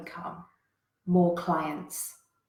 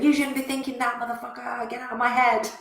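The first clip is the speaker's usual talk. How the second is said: angry self-talk; 4 semitones higher than usual. Retold or imitated. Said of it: "going to war with it and going".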